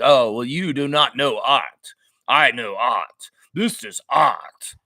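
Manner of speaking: slow, drawn-out snooty art-snob voice; pompous artist looking down on critics, slow and superior; arrogant artist. Retold or imitated. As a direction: lord it over them like a pompous artist. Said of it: "He says it like that".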